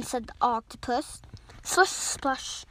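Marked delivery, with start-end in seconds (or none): 1.6-2.6 unvoiced swishing splash; water sound effect. Storyteller mimics the new ocean, unvoiced splashy effect. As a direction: whoosh the words like moving water.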